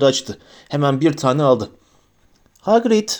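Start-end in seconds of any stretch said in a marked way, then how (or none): 2.6-3.1 high, tentative calling of a name; a touch of nervousness, pitched up; timid. A narrator's reading. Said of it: "dedi Hermione ürkek ürkek".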